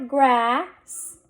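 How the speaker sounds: grass drawn out, rising, s held apart; blended slowly. Shown as a demonstration. Teacher sounding out a word for readers, blended slowly. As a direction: stretch the vowel in grass before the s. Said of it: "Let's read this".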